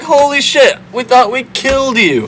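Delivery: high, stunned squeak; mock shock. Brothers stunned to learn it is Joseph, squeaking in mock shock.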